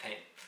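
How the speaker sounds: "thanked" lisped, th sound at end. Retold or imitated. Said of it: "she would say it like that".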